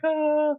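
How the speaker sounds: high, loud mock angelic choir note. Imitating angels as the star walks in, a high mock choir note. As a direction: sing out a heavenly ahh. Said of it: "you hear the angels".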